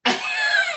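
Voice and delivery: high, loud laughing burst; burst of laughter. Real, not acted. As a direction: laugh out in sudden delight. Laughs at friend's refusal.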